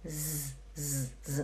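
soft, sustained voiced zzz; buzzing Z sound. Shown as a demonstration. Teacher showing how the S sounds, buzzed Z. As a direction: buzz a Z in short bursts. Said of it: "tem som de z".

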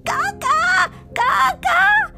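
put-on high, loud child's scream of a name; screaming for Gaga. Little girl alone screaming for her grandma. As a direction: call out in a panicked high scream. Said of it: "she started screaming".

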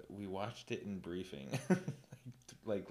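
ordinary talk

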